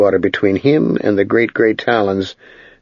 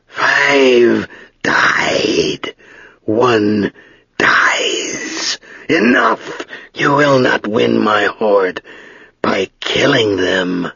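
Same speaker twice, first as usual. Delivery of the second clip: slow, drawn-out, dry dragon voice; great dry voice of an ancient dragon; much slower than usual. Acted. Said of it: "the great dry voice of the dragon".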